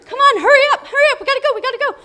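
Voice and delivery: high, loud hurrying shouts; frantic, exasperated hollering; harried parent yelling. Retold or imitated. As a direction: rush them out the door, yelling. Acts herself rushing her kids.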